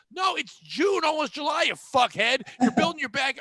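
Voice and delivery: ranting at pool shoppers in June, shouting; mock shouting rant. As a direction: yell it out in comic frustration.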